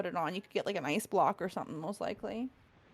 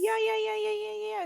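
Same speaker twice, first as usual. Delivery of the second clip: excited; 11 semitones higher than usual. Real, not acted. Excited agreement about snow syrup.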